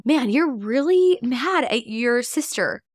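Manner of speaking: slow, empathetic naming; validating parent. Shown as a demonstration. Parent to child pointing at doll, slow empathetic. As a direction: reflect the anger back gently. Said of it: "put into words how they are feeling".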